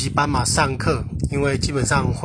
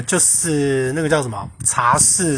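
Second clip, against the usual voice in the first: ordinary talk, nothing marked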